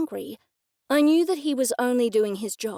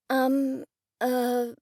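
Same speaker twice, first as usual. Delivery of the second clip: stammering; 2 semitones higher than usual. A narrator's reading. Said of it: "I stammered".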